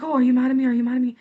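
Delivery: lightly acted plea for reassurance; anxious checking. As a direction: imitate yourself nervously checking in. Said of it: "I'm always convinced".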